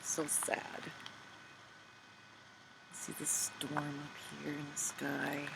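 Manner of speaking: a soft, sad whisper; hushed whisper. A narrator's reading. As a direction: let the words fall to a whisper of sadness. Sad moment, voice drops away.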